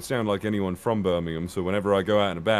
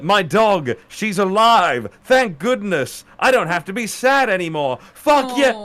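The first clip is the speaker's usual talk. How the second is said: high, louder, slow cry of relief; retold elation; 9 semitones higher, 4 dB louder, slower than usual. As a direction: relive the overjoyed relief, dragging it out. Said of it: "kept on having dreams".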